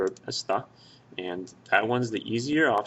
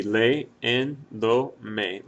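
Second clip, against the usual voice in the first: "leyéndome" very slowly, one syllable at a time; broken into syllables; 3 dB louder than usual. Shown as a demonstration. Teacher showing the class the syllables, "leyéndome" slowly broken apart. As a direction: draw out "leyéndome" with each syllable separate. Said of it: "broke that down into its syllables".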